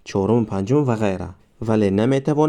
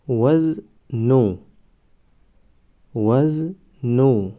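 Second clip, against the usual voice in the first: وزنو stretched very slowly, syllable by syllable; drawn-out demo; much slower than usual. Shown as a demonstration. Teacher modelling the word for students, very slow. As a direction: draw out وزنو slowly for the class. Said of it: "ما باید همان حرف را فقط آوازش را تلفظ کنیم".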